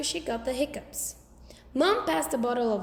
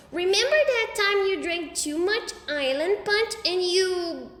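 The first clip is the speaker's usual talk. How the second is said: put-on little kid voice; 7 semitones higher, 4 dB louder than usual. A narrator's reading. Fudge blurting.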